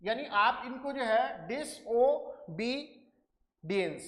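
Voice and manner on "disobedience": "disobedience" broken into separate, drawn-out syllables. Shown as a demonstration. Teacher counting out the meter for students, "disobedience" split syllable by syllable. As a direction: say "disobedience" slowly, one syllable at a time. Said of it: "जैसे आपका ये एक syllable".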